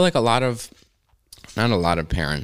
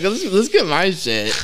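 high, slow, wheedling mock request; eager pleading; 11 semitones higher, 4 dB louder, slower than usual. Teen urging the dad to switch, high eager plea. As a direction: play a kid pushing his own stash.

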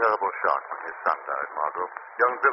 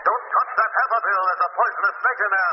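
high, pressing warning; urgent shout; 11 semitones higher than usual. Acted. The Shadow warning Bill of the poisonous snake, urgent shout. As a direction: yell at him to stop.

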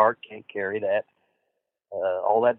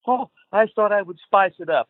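higher put-on Sheik voice, innocent; imitating the Sheik; 9 semitones higher, 3 dB louder than usual. [name] imitates the Sheik excusing his bleeding. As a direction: play dumb like the Sheik.

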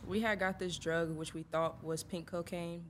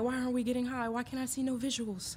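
higher-pitched retold impatient whine; raised-pitch puzzled griping, retold; mock complaint; 7 semitones higher than usual. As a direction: act out baffled impatience from back then. Acts out their impatient complaint.

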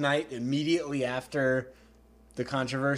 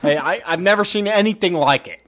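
loud, higher-pitched sports pundit impression; hammed-up, emphatic sportscaster amazement; Mel Kiper; 6 semitones higher, 11 dB louder than usual. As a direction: gush like an over-the-top TV analyst. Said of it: "that was a terrible Mel Kiper impression".

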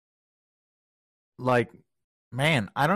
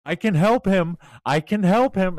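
higher, louder, insistent begging to be let through; retold plea; 8 semitones higher, 5 dB louder than usual. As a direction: push to be allowed to help. Doctor pleading to help.

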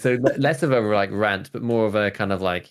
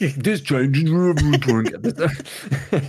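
slow, louder, weighty announcement; dramatic trailer voice; 5 semitones higher, 3 dB louder, much slower than usual. Acted. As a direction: drag it out like a movie trailer. Dramatic hype after teasing big news.

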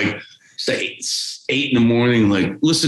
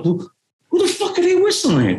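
very high, freaked-out question; panicked disbelief. Retold or imitated. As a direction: squeak it out in nervous shock. Kids confused in park.

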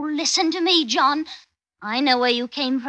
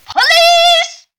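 screaming much higher and louder; shout; 13 dB louder than usual. Acted. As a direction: scream for help. I'll yell for the police.

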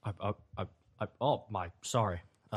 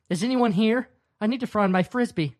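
raised voice calling out; shouting; 8 semitones higher than usual. Acted. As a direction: call out into the house. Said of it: "I shouted".

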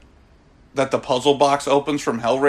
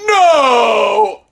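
high, loud mock "No!"; mock dramatic shout; 7 semitones higher, 5 dB louder than usual. Imhotep runs into frame, hammed-up dramatic shout. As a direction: ham up a dramatic cry of despair.

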